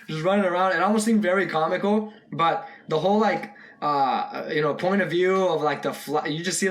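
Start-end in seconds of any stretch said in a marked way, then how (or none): none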